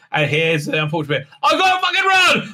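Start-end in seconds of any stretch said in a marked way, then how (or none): 1.4-2.5 high, squeaky panicked yelp; mock panic. Imitating Tilly panicking as she runs. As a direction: shriek it like a cartoon coward.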